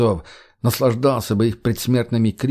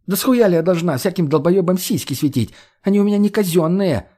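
indignant rant; 7 semitones higher than usual. Acted. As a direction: rant in outrage at the gunman. Margo ranting at gunman. (возмущённо)

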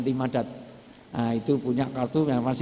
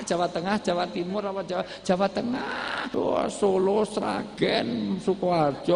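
1 mock nosy rescuer; 6 semitones higher than usual. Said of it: "Nggak usah lihat suku".

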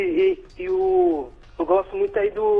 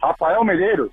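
over the phone. Real, not acted. Ouvinte ao telefone.

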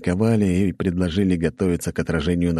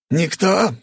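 very high, loud outraged yell; furious shout; 4 dB louder than usual. Acted. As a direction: scream at the silent lords. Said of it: "закричал тот".

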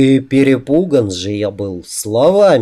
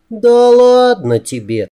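high, drawn-out female voice; teasing woman; 10 semitones higher, 3 dB louder, slower than usual. A narrator's reading. She brushes off his embarrassment, high and teasing. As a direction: brush it off in a high playful lilt.

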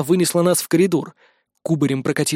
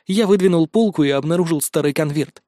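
ordinary delivery, nothing marked

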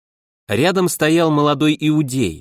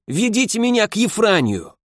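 hoarse rasp; 8 semitones higher than usual. Acted. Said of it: "прохрипел я".

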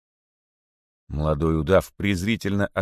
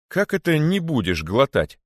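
higher, stern demand; stern question; 9 semitones higher, 4 dB louder than usual. A narrator's reading. Old rabbit sternly questioning the boa, higher stern voice. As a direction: demand an answer like a strict teacher. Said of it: "строго спросил задумавшийся".

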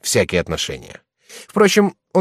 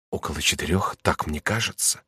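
тихо, дрожащим голосом; робко, со страхом; trembling answer; 3 semitones lower, 7 dB quieter than usual. A narrator's reading. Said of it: "ответил весь дрожа". (дрожа от страха, тихо)

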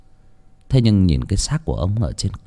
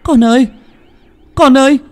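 loud, high-pitched call; mother calling; 7 dB louder than usual. A narrator's reading. Mother calling her child from afar, high loud call. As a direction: call out urgently to your child. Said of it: "nghe tiếng mẹ gọi".

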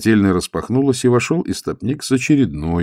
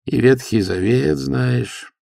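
медленно, с хитрецой; протяни с лукавым прищуром; хитро; 6 semitones higher, slower than usual. A narrator's reading. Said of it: "хитро прищурился истопник". (истопник хитро подначивает монаха, медленно)

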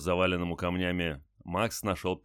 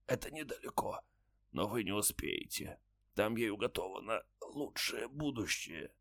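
faint deathbed whisper; 4 semitones higher, 8 dB quieter than usual. A narrator's reading. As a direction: breathe it out like a dying man. Dying soldier continues.

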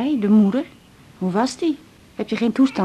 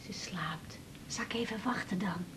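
put-on soft whisper so the baby sleeps on; 2 semitones lower, 18 dB quieter than usual. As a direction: breathe the words, don't wake her. (fluisteren bij het slapende kind)

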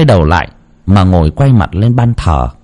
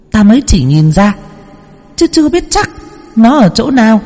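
put-on much higher, ringing child's voice; child spirit voice; 12 semitones higher than usual. Possessed medium telling Lan about her dead brother. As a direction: speak as a knowing spirit child. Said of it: "Cô Thao đáp".

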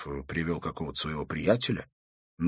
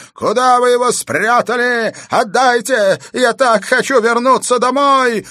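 very high, loud wailing demand; madman's yell; 9 dB louder than usual. A narrator's reading. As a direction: yell it like an escaped lunatic. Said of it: "завопил Пельт". (истошный вопль безумца)

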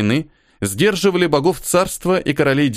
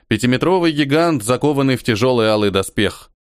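ordinary delivery

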